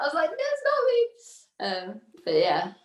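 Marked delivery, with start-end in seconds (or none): 0.3-1.1 squeaky high protest; much higher, flustered denial; mock protest. Teacher protesting to examiner she isn't the pupil, high-pitched. Denying being the fourteen-year-old.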